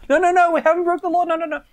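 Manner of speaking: high, far-off playful denial; mock protest. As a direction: cry out in fake innocence. Teasing women about breaking law.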